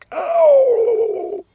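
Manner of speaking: very high, loud inhaled ow; turkey gobble call. Shown as a demonstration. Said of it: "for the gobble, you wanna go".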